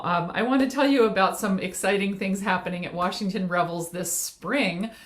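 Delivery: ordinary talk, nothing marked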